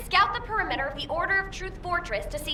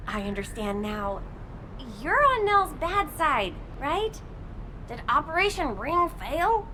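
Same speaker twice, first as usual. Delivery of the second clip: usual speaking voice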